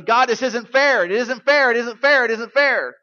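loud, high, pleading protest; a desperate mock lament, shouted up to heaven; crying out to God. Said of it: "that's when we cry out and say".

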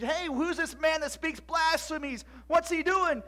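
high, loud, drawn-out challenge; outraged Pharisee. Acted. Pharisees demanding who this blasphemer is, outraged. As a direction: call him out indignantly.

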